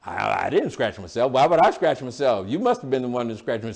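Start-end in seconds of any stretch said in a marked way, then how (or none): none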